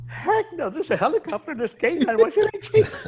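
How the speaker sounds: high, amused scoff. Retold or imitated. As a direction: laugh off the question. Retold partner scoffing.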